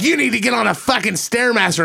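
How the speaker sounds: retold insult. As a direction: imitate her sneering jab, up high. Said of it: "she said that thing".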